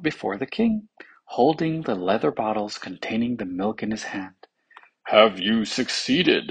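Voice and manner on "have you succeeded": slow, mildly anxious question. A narrator's reading. King asks the returned officer, mildly anxious. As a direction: ask with a touch of anxiety. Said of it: "inquired the king anxiously".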